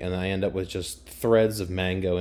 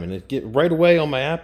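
ordinary talk, nothing marked

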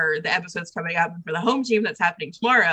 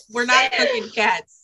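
high squeal of laughing protest; slower than usual. Real, not acted. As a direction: protest through giggles, slowly. Laughing protest about typo.

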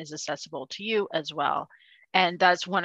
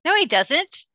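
indignant; 11 semitones higher, 5 dB louder than usual. Real, not acted. Heated duck argument.

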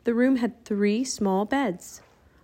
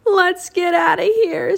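high, loud and drawn-out; panicked cry; 9 semitones higher, 6 dB louder than usual. A narrator's reading. Jack wants to flee the ghosts, panicked cry. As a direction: call out slowly in high-pitched alarm. Children flee moving chess pieces.

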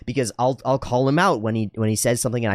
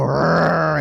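put-on loud, raised roar mirroring a kid; mock roar; 6 semitones higher, 5 dB louder than usual. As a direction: roar back at an angry child. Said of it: "you go".

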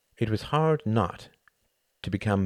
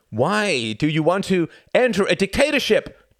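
loud, pointed challenge, higher; demanding; 12 semitones higher, 8 dB louder than usual. A narrator's reading. Tom challenging the German drinkers, demanding. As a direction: press them for an answer. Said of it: "demanded Tom".

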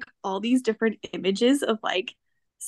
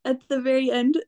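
ordinary delivery

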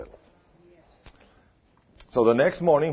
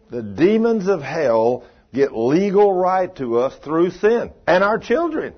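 preaching at full force; 5 semitones higher, 5 dB louder, slower than usual. Real, not acted. Preacher drives home his point.

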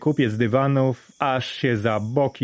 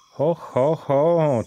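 delighted, higher chuckling exclamation; joyful little cry of surprise; gleeful ho ho ho; 5 semitones higher, much slower than usual. A narrator's reading. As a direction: marvel at the silver coin. Dari delights at the coin.

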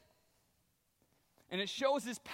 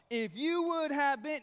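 sharp, pointed reproach, higher pitch; accusing sister; 6 semitones higher, 4 dB louder than usual. Retold or imitated. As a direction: wag a finger at him as you say it. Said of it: "I had just imagine her finger waving it".

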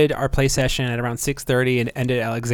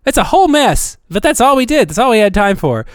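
high, loud hyped show recap; excited recap; 7 semitones higher, 4 dB louder than usual. Acted. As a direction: pump up the recap like a promo.

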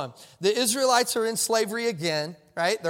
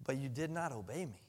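soft rebuke; 7 semitones lower, 14 dB quieter than usual. Acted. Preacher quoting God's rebuke.

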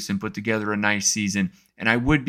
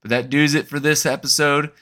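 Kentucky "doos", rhymes with "choose", said a touch higher; Kentucky accent; 5 semitones higher, 4 dB louder than usual. Shown as a demonstration. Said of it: "channel in my inner Kentucky accent".

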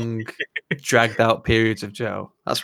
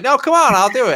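mock-eager, high and loud; a soldier begging to go first, jumping in; eager insistence; 13 semitones higher, 6 dB louder than usual. Mock soldier insisting eagerly he'll lead the charge, high and loud. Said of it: "And he's like".

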